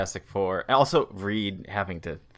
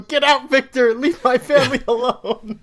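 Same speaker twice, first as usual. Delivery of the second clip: furious high shout, much louder and higher; yelling at her; 9 dB louder than usual. Retold or imitated. Father yelling at possessed daughter to free her, furious high shout. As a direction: scream at the villain hiding in your baby. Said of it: "Just yelling at her".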